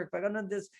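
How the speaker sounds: normal talking voice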